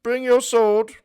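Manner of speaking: loud, high mock-royal summons; king calling his knight. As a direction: call out for your champion like a king.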